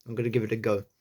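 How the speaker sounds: usual speaking voice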